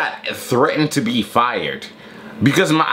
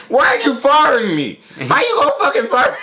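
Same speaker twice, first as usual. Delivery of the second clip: mock furious rant; 12 semitones higher than usual. To the bus driver who fired him, high outraged yelling. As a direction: rant like an eight-year-old fired for nothing. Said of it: "I'm mad as shit".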